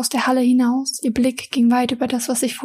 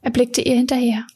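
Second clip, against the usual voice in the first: usual speaking voice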